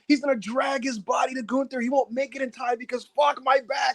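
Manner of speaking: high, fast fan hype; hyped-up play-by-play. Real, not acted. Podcast host hyping his friend about Randy's finish, high and excited. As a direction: call the match like it's happening.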